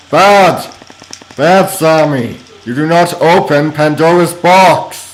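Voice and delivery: loud, high-pitched telling-off; playful, emphatic reprimand shouted out; mock scolding. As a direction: scold a naughty culprit at full voice. Scolding Sammy for opening the box.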